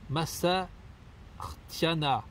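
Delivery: "massartiana" drawn out very slowly; sounding out. Real, not acted. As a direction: stretch "massartiana" syllable by syllable. Déchiffre un nom latin douteux. (« massartiana » déchiffré très lentement)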